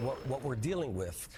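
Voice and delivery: usual speaking voice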